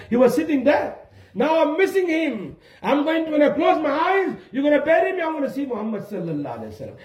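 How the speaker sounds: high-pitched fervent storytelling; impassioned preaching. Acted. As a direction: preach the dying man's longing.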